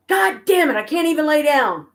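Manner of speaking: high, loud imitated yell of frustration; retold yell. Husband yelling from bed that he can't lie down, imitated angry shout. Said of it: "he yelled".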